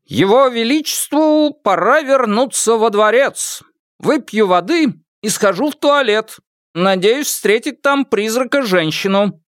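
high-pitched, pompous royal announcement; grand, sustained high declamation; mock-solemn proclamation. A narrator's reading. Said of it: "торжественно сказал".

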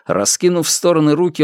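ordinary delivery, nothing marked

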